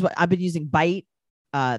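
ordinary talk, nothing marked

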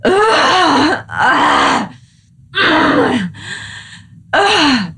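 high angry groans, let them out; frustrated groans. Shown as a demonstration. Woman to partner, voicing anger with raw groans. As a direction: growl the anger out of the body. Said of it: "if you go".

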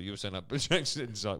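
usual speaking voice

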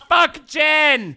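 loud, high-pitched outburst of rejection; emphatic shout. Real, not acted. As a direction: shout it out in disgust at the drink. Loudly rejecting the gin they tasted.

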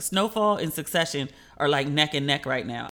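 ordinary delivery

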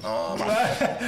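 protesting. Retold or imitated. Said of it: "I was like".

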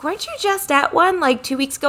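a gentle mock of a sweet eighty-something client; a hint of a high old-lady voice. Retold chat with elderly client.

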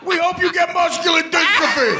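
high-pitched mock heckler shout; screaming hecklers. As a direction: imitate kids yelling abuse from the crowd. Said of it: "twenty-four kids from Southern California screaming".

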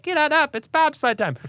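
put-on high, bright chant of a tiny kid; mock baby cheer. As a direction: squeal it like an excited toddler rallying. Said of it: "my baby to be like".

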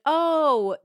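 a lightly voiced, fast, falsely sweet husband pretending nothing happened; bright fake sweetness, as if nothing is wrong; mock innocent sweetness. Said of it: "trying to put on the show again".

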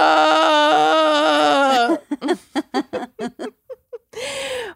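high burst of laughter; laughing. Real, not acted. Big laugh after introductions.

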